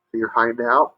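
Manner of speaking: usual speaking voice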